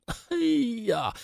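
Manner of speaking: high, soft falling wail. Acted. Old mother slapping her thighs in despair, wailing. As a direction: let out a drawn-out old woman's lament. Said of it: "开始哭啊".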